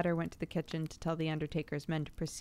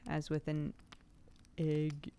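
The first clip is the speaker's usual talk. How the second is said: usual speaking voice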